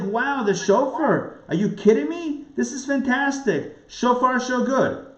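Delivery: high-pitched mock amazement, wowed; excited. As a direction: gush like a thrilled fan. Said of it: "We ought to be excited".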